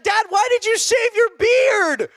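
high, loud mock crying plea; mock toddler wail, loud and high; hammed-up wailing child. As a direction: whine it like a baby who lost her dad's beard. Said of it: "She's like".

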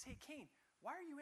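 a lightly voiced gentle God. God gently questions Cain.

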